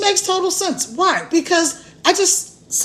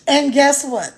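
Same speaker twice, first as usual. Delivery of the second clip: ordinary talk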